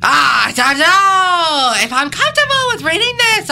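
high, loud hammed-up Russian accent. Helper balking at the script, loud mock Russian accent. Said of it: "It's excellent accent".

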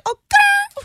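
squeaky mock gal-pal squeal; squealed oh girl. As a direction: squeal it like a giddy girlfriend. Mocking [name] and [name] with ghosts.

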